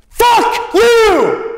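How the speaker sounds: father screaming at his son, piercing yell; screamed curse. Retold or imitated. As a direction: explode at the kid at full volume. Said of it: "He'd look at me and say".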